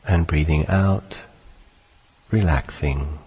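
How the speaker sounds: calm, unhurried meditation voice with long pauses; guided meditation. Acted. Teacher leading listeners through sitting and breathing, calm guided meditation. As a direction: guide listeners gently into stillness, phrase by phrase.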